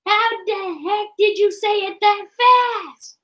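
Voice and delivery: amazed. Real, not acted. Amazed at fast speech.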